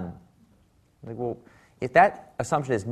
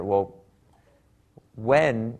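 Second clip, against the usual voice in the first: ordinary talk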